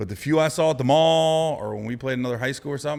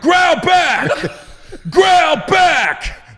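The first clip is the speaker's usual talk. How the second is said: high, loud shouted command with a laugh; shouted order; 12 dB louder, much slower than usual. Retold or imitated. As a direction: bark the order at him. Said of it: "I was like".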